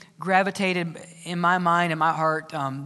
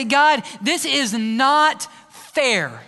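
very slow, louder plea, drawn out; mock protest; 4 semitones higher, 4 dB louder, much slower than usual. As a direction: stretch out a wounded complaint. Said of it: "and I say".

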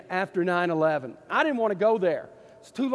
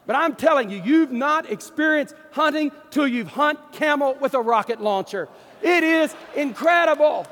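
excited shout; 5 semitones higher, 4 dB louder than usual. Real, not acted. Preacher thrilling his congregation with a camel-hunting tale, excited shout. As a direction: voice jumps up high and loud with glee.

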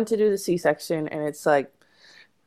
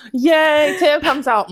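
mock cheer; 9 semitones higher, 5 dB louder, slower than usual. Mock cheer at baby's birth.

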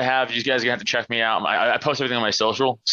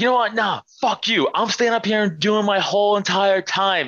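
defiant yell; 9 semitones higher than usual. Retold or imitated. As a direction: refuse to back down, fire back. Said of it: "he's like".

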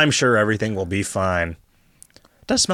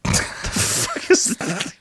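laughing; much slower than usual. Real, not acted. Laughing at odd phrase.

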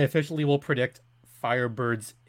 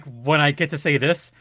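ordinary talk, nothing marked

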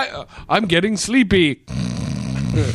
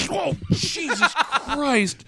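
high laughing cry; much slower than usual. Real, not acted. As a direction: laugh and yelp. Laughing fit on electric floor.

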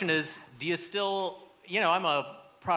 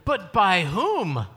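dramatic; 3 semitones higher, 7 dB louder, much slower than usual. Acted. Dramatic mystery question.

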